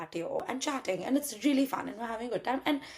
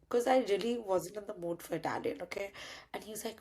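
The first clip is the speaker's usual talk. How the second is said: normal talking voice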